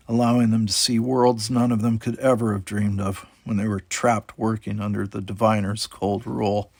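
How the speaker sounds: ordinary delivery, nothing marked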